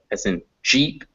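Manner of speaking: ordinary talk, nothing marked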